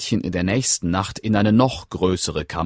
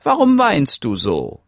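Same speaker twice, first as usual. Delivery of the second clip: little man; 8 semitones higher, 5 dB louder than usual. Acted. Männlein spricht zum Mädchen.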